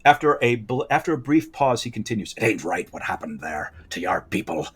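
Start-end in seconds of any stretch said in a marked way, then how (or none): 2.4-4.7 put-on low, hushed, whispery old pirate; old pirate hare. Old hare speaks of your people's fate, hushed pirate voice. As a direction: drop to a grave pirate whisper. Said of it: "this old hare is a pirate".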